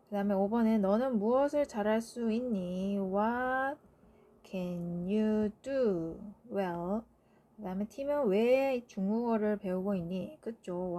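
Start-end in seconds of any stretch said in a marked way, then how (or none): 3.0-7.0 slow dictation. Shown as a demonstration. Teacher dictating answer slowly.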